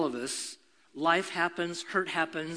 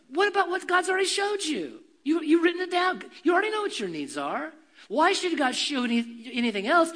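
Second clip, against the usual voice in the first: forceful preaching; 7 semitones higher than usual. Real, not acted. Pastor challenging the congregation, forceful preaching. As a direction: press the point hard on them.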